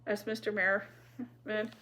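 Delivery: ordinary delivery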